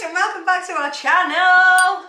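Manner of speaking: bright, loud vlog welcome; excited greeting. Real, not acted. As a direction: greet the viewers with energy. Channel intro greeting.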